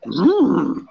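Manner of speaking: high drawn-out Yoda croon; a long, high creature hum; Yoda impression. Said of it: "is Yoda walks around consistently going".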